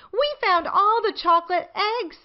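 put-on high proud little-girl voice; Peppa's voice. A narrator's reading. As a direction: boast it brightly like a small child. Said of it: "says Peppa".

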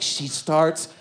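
ordinary delivery

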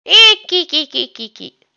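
put-on villain cackle, fading away; goofy menacing laugh. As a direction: laugh in a string of silly eeks. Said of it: "I just told you to stop laughing like that".

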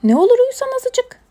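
high, loud pleading; coaxing plea. Acted. Animals urge Mina to sleep, high insistent pleading.

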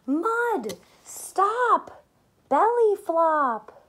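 high, loud, dramatic calls; excited exclaiming. Acted. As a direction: shout it out like a big splash. Reading dramatic rhyme.

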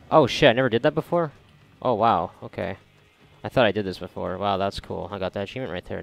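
usual speaking voice

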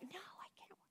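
breathless whisper; soft whispered no; whispered refusal. Acted. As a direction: hush it as if tempted. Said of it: "And you're like".

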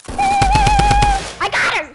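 long high victory shriek; triumphant scream. Real, not acted. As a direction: stretch it into a screaming cheer. Triumphant kill in game.

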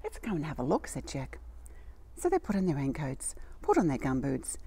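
put-on gentle read-aloud storytelling for children; storybook reading. A narrator's reading. Reading a story to little children, storybook voice. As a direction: tell it like a bedtime picture book. Reads a picture book aloud.

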